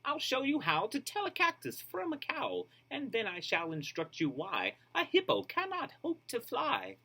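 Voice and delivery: high, bouncy teacher voice; chirpy teacher. Acted. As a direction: bounce through it like an excited teacher. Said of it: "she chirps".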